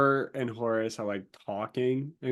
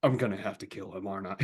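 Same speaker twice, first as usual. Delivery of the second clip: low, quick, resigned aside; retold weary annoyance, softer and lower; annoyed Horus; 5 semitones lower, 6 dB quieter, faster than usual. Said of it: "Horus is just so annoyed".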